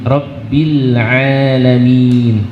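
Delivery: slow "rabbil alamin" with throaty ain. Shown as a demonstration. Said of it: "jangan tertukar dengan huruf hamzah". (ain jelas dari tenggorokan)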